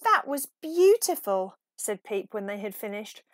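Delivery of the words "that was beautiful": high, loud and delighted; awed. Acted. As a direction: gush with wonder. Peep awed after the song.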